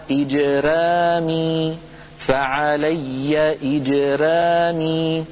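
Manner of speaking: إجرامي slowly with a light thin ra; thin ra recitation. Shown as a demonstration. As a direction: intone فعلي إجرامي slowly. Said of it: "كقوله تعالى".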